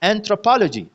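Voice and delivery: Anthropology with throw, लॉ and जी weak, पो stressed; unstressed syllable demo. Shown as a demonstration. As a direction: lift पो in anthropology, let throw, लॉ and जी go light. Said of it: "ये तीनों unstressed syllables है". (Anthropology में पो पर ज़ोर, throw, लॉ, जी हल्के)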